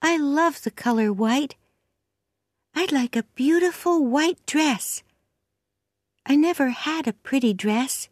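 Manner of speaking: put-on high-pitched little girl voice; small girl's high voice; chatty orphan girl. A narrator's reading. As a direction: talk as an eager child confiding, pitched high.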